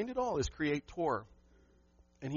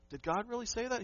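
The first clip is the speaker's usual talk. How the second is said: higher, teasing serpent question; a lightly voiced tempter sowing doubt; sly serpent; 7 semitones higher than usual. Pastor voicing Satan questioning God's word, higher and sly. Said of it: "This is what Satan does".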